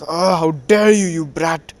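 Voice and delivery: mock-furious outburst in a retelling; outraged retort. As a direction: burst out offended, then drop on the insult. Retold quarrel, Tsunade insulted.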